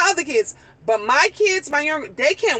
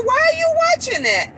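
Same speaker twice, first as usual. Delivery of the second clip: much higher, astonished mom voice; amazed question; faster than usual. Retold or imitated. Mother asking her teen son why he watches space videos, astonished and high. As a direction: pitch it up in pure disbelief.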